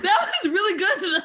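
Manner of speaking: high, loud laughing praise; thrilled, pitch leaping up with laughter; delighted surprise. Real, not acted. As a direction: burst out impressed, laughing through the words. Delighted reaction to accent demo.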